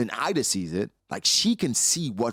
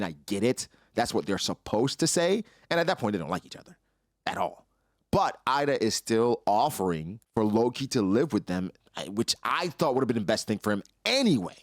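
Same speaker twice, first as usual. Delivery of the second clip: normal talking voice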